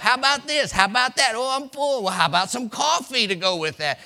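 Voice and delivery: high eager offering voice; Barbie fussing over Ken. Retold or imitated. Wife fussing over husband, high and eager. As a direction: coo each offer up high like a doting wife.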